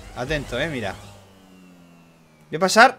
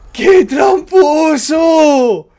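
chillido agudo, lento y alargado; protesta a gritos; 9 semitones higher, 9 dB louder, much slower than usual. Real, not acted. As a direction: grita la acusación estirando cada sílaba. Protesta por la trampa. (lamento gritado de indignación)

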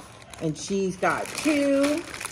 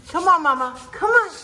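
high coaxing call; calling sweetly to the little dog; 10 semitones higher, 3 dB louder, faster than usual. Real, not acted. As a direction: coax the pup along.

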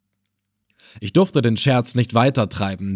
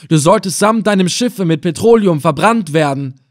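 higher, loud, outraged demand; furious commander; 6 semitones higher, 6 dB louder than usual. A narrator's reading. Reis Effendina realising the plot, furious. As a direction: force the confession out.

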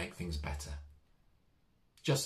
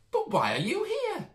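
much higher, squeaky little mouse; Harry the mouse puzzled by the empty village, squeaking; 6 dB louder than usual. Acted. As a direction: ask it as a small curious mouse. Mouse Harry asks the nurse.

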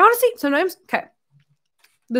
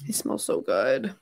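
soft, low cooing; 9 semitones lower, 9 dB quieter, slower than usual. Real, not acted. Sniffing the cat lovingly.

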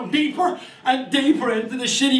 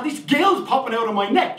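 ordinary delivery

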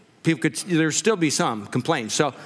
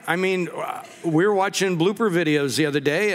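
normal talking voice

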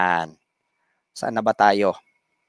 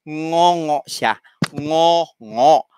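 nasal voice; much slower than usual. Shown as a demonstration. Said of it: "Ngongo nga siya".

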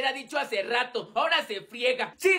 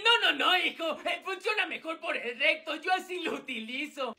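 usual speaking voice

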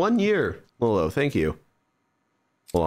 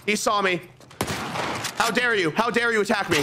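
high panicked yelling; frantic, pitch shooting up; panicking gamer; 12 semitones higher than usual. Real, not acted. Spotted by enemy, panicking.

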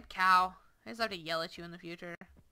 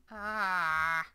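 frustrated shout; 3 semitones lower, 12 dB louder than usual. Real, not acted. Frustrated at the cows.

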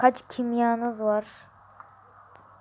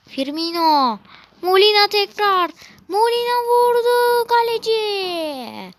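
higher, louder building call; screaming football commentator; 9 semitones higher, 5 dB louder than usual. Pretend. Commentating attack.

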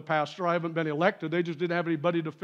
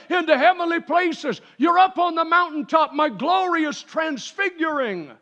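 full-force preaching; 8 semitones higher, 5 dB louder than usual. Real, not acted.

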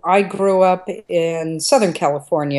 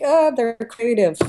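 higher-pitched mock approval; indulgent onlooker; 8 semitones higher than usual. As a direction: lightly imitate adults excusing naughty kids. Voices everybody's indulgent reaction back then.

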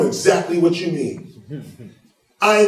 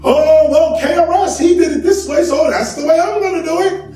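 mock copycat fan; 10 semitones higher, 5 dB louder than usual. Mocking copycat fans' voices.

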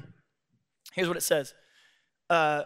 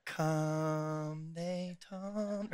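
mock labor groan; 10 dB quieter, much slower than usual. Acting Mary in labor groan.

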